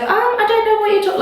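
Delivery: high, rapid imitated denial; hurried mock-innocent protest; mock innocence. Mimicking German lady feigning innocence.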